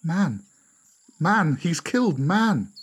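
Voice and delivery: high, voiced cries of alarm; shocked animals. A narrator's reading. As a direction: spread the horror in high-pitched exclamations. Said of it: "A whisper went up among the animals".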